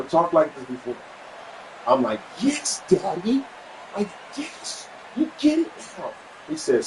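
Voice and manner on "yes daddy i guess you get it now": delighted high approval. Retold or imitated. Praising father's new resolve.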